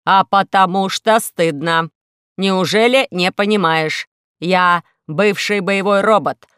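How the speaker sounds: slow, loud, measured robot voice; heavy, deliberate robot lament; glum robot. Acted. Robot complains to Alisa about being sticky, slow and heavy.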